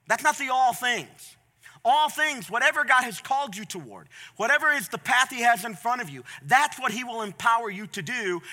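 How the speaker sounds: forceful, measured sermon delivery; emphatic preaching. Real, not acted. Preacher correcting how people read a verse, emphatic. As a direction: preach it firmly, stressing each phrase.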